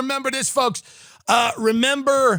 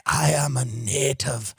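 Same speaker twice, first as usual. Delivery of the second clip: Nathan Phillips; 8 semitones lower, 4 dB quieter than usual. Retold or imitated. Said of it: "Nathan Phillips came in".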